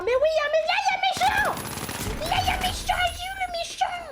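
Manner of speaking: shrieking hyperactive hunt; hyper yelling. Real, not acted. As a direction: bark out every enemy she sees. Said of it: "Oui, j'aboie".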